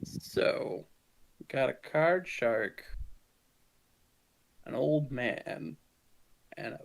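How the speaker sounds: slow, deliberate mob boss sizing them up; gangster boss voice. Acted. Boss addressing the crew, slow and deliberate. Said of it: "He's like".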